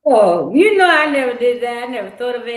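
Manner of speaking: a real aha moment; surprised realization. Real, not acted. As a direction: it suddenly clicks, say it out loud. Sudden realization.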